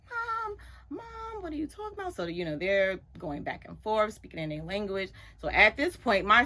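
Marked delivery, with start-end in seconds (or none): 0.1-2.1 protesting daughter. Retold or imitated.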